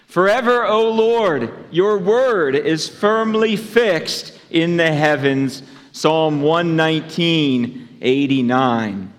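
loud, slow, measured scripture recitation; group recitation. Real, not acted. Preacher leading the congregation in the month's memory verse, slow recitation. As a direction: lead everyone in reciting the verse, loudly and deliberately. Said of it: "Let's say it together".

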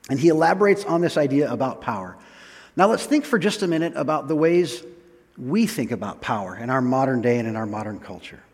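ordinary talk

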